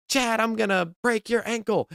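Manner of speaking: a mild mock yell at Chad; mock screaming. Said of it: "she started screaming and she's like".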